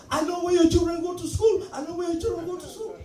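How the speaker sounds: high, repeated threat; menacing threat. Retold or imitated. The washer threatening the driver's children, high and menacing. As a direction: lean into the threat about the kids. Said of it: "you know".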